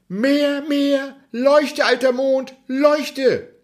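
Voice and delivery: hohe, fordernde Kinderstimme; gieriges Kind. Acted. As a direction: fordere es hoch und gierig wie ein kleiner Junge. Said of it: "antwortete dieser". (Häwelmann fordert hoch und gierig vom Mond mehr Licht)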